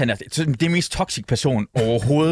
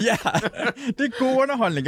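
laughing; 8 semitones higher than usual. Real, not acted. Laughs about people heartbroken.

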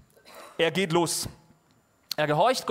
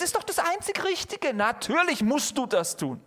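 self-righteous; 8 semitones higher than usual. Retold or imitated. Mocking self-righteous inner voice.

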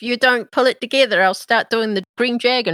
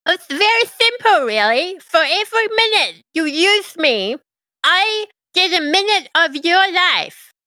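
higher, eager little servant; imp voice; 8 semitones higher, slower than usual. Acted. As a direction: play a bound imp, brightly.

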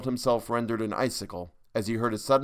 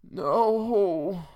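higher moaning No; higher despairing moan; 10 semitones higher, 3 dB louder than usual. A narrator's reading. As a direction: moan in dismay. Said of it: "moaned Silkline".

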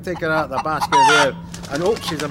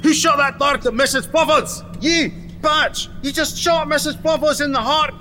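high-pitched indignant accusation; outraged; 7 semitones higher than usual. Acted. Trainer whose pet chicken was just shot, outraged. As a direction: accuse them in shocked outrage.

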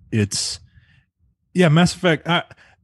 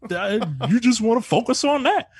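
mock hurt whine; 12 semitones higher than usual. Player hurt by Liara.

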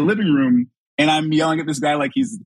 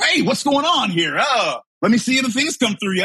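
put-on store guy; 9 semitones higher than usual. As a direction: imitate a store guy breezily stepping in. Manager finally appears in the retold story.

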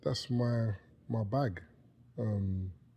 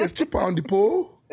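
put-on high-pitched, incredulous Nigerian-accented question; mock Nigerian accent, pitched way up; Nigerian mom; 9 semitones higher, 5 dB louder than usual. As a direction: play a shocked Nigerian mother. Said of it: "Nigerian mom".

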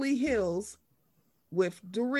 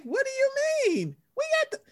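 mock Andy; 14 semitones higher than usual. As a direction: play dumb in a high squeak. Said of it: "He was like".

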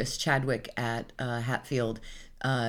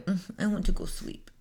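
put-on soft, high baby voice; sleepy baby talk; 5 semitones higher, 5 dB quieter than usual. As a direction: whine it like a sleepy toddler. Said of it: "And so I talk like that".

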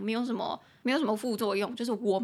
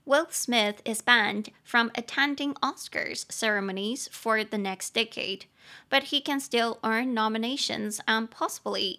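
slow, careful English read; slow model sentence read. Shown as a demonstration. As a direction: read it slowly for learners. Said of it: "念一次".

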